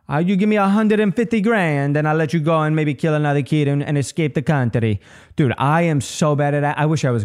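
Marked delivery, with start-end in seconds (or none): 0.1-5.5 hammed-up Italian mafia accent, slightly raised; bad Italian accent. Mafia to a defendant over bail, bad Italian accent. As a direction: do a clumsy Italian mobster accent. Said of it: "they don't have Italian accents".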